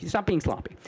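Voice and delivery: usual speaking voice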